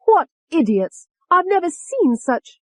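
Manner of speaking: high, loud, slow contempt; exasperated high-pitched derision; scornful anger. A narrator's reading. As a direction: draw out the insult angrily. Angry alter scorns the witnesses.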